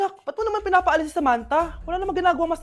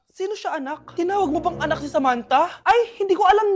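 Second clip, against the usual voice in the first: usual speaking voice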